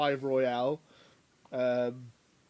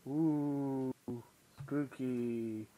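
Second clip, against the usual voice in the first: spooky ghost voice; 5 dB quieter, much slower than usual. Pretend. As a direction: breathe it out like a ghost. Spooky Halloween episode intro.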